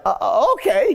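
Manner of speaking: high, hesitant okay; confused reply. Retold or imitated. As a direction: stammer it out, thrown off balance. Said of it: "they're confused".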